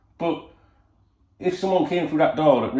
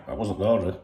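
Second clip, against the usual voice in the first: usual speaking voice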